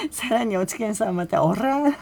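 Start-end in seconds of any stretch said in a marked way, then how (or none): 1.4-2.0 "オラー" blurted loud and high with a trilled R; imitating a brash "オラー" with rolled tongue; rough rolled-R Spanish. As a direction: blast out "オラー" like a tough guy. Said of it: "そのベラン名調のスペイン語でね".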